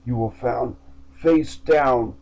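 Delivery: usual speaking voice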